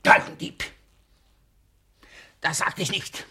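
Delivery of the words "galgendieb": furious shout. Acted. Master cursing his servant, furious yell. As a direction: spit the insult at full volume. Miser abuses suspicious servant.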